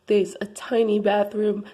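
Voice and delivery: usual speaking voice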